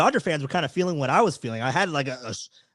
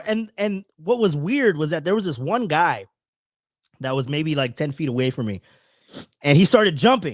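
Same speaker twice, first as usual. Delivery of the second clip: usual speaking voice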